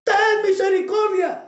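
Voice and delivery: high, loud shout to heaven; desperate cry for mercy. Acted. Preacher acts out people of Sodom under falling fire, desperate shout. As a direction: cry out at full voice, begging for mercy. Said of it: "volteaban y gritaban".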